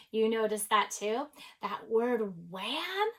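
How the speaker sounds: usual speaking voice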